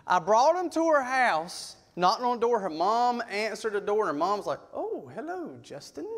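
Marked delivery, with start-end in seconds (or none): 4.7-6.1 soft, high, swooping hello; awkward mother greeting. Retold or imitated. Mom answering the door to daughter's ex, soft swooping greeting. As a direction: imitate an uneasy mom, softly.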